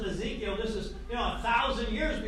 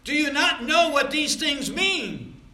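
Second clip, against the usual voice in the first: forceful scripture reading; 6 semitones higher, 8 dB louder than usual. Acted. Preacher thundering God's message to the rebellious house. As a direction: proclaim it slow and loud, pressing each phrase.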